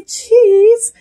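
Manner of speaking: high, playful "Cheese" in a put-on voice; silly sign-off. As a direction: toss off a goofy goodbye.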